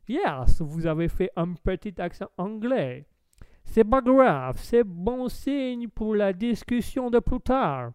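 loud, hammed-up English accent. Streamer answers as my lord, mock English accent. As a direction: play a British lord speaking French. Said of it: "est-ce que c'est un accent anglais, euh, my lord".

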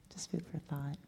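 whispered aside. Real, not acted. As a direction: breathe it out as a soft whisper. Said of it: "whispered aside".

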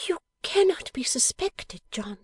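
very high, breathy wifely voice; high whispered alarm of a wife; Bella, aghast. A narrator's reading. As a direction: gasp it out in high, whispery disbelief. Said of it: "palpitating heart, Bella grasped him".

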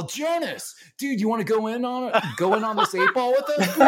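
high, fast, laughing bro voice; mock party-bro invite, rushed and giggly; imitating a hyped-up Steve. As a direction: ham up a coked-up buddy pitching a deal. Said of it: "he's all".

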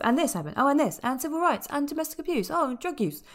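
high-pitched, piled-up mock list; mock list-reeling. Critic mocking a film that crams everything in, high-pitched listing. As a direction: reel off each item as yet another thing. Mocking a film's crammed checklist.

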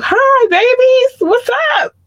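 very high, drawn-out squeal; squealed greeting. Real, not acted. Podcaster greeting listeners in Switzerland and Indonesia, squealed greeting.

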